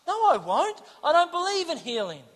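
high-pitched protest; stubborn refusal. Retold or imitated. Ernie refusing to go up front, stubborn protest. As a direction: refuse like a balky doubter.

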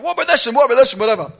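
high, loud mock badgering; worked-up, insistent retelling; eager preaching. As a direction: imitate someone excitedly pressing questions on people. Acting out her eager preaching.

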